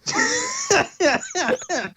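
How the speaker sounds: squeaky giggle; high laugh. Real, not acted. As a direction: giggle helplessly. Laughing while reading.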